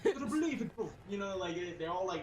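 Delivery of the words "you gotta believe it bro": put-on high British street accent; John Boyega. Quoting a film to a laughing friend, British accent. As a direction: urge it in a mock London accent. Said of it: "John Boyega and he's like".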